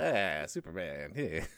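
low, slow, mumbling sailor growl; Popeye voice. Retold or imitated. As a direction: grumble it like Popeye. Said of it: "what would Popeye have to say about Superman".

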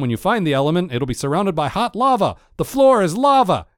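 starts level, ends in a high excited shout; mock-dramatic warning rising to a yell; quoted warning building to a shout. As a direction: climb to a squeal on the lava line. Quoting, building to a shriek.

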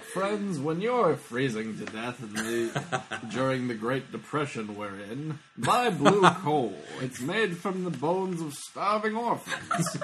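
very slow, drawn-out vintage sales read; old-time radio pitchman. Acted. Host performing his favorite coal sponsor spoof, slow radio pitch. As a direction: stretch every phrase like a Depression-era announcer. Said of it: "That was always my favorite. Just like".

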